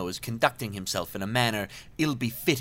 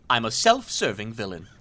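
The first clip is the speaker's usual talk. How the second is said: ordinary talk, nothing marked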